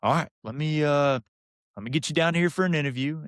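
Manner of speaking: high imitated country-coach voice; Coach Vincent. Coach offers an interview, imitated high country voice. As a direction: play the country coach thinking it over. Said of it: "good old country boy from Vernon".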